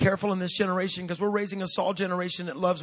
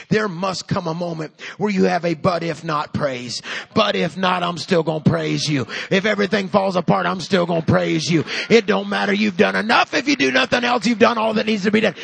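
loud, driving preacher's climax; fervent pulpit proclamation; full-force preaching; 6 dB louder than usual. Real, not acted. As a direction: build to a loud, rousing declaration.

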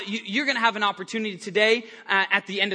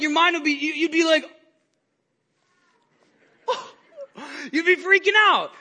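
ordinary talk, nothing marked

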